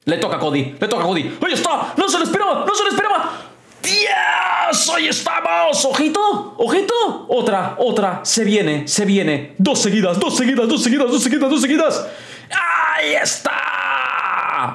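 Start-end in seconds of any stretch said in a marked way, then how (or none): 1.4-12.1 wrestling commentator. Acted. 12.5-14.7 long, slow, high commentator shout; drawn-out excited shout. Real, not acted. Big wrestling move, drawn-out shout.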